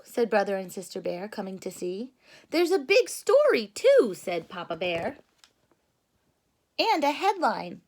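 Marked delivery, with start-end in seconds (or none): 2.5-4.1 much higher and louder, excited; hammed-up excited Papa Bear. Papa Bear voiced in read story. 6.8-7.8 higher, louder storybook dad; lightly hammed-up Papa Bear. Papa Bear voiced in read story.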